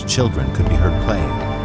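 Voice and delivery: ordinary delivery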